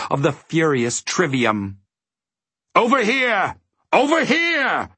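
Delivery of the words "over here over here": high, sing-out call from afar; advising call. A narrator's reading. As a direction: call them over, much higher than usual. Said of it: "advised the voice".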